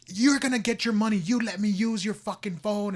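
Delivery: high-pitched imitation of an angry man; irate retort. Retelling old man refusing to return the phone, irate.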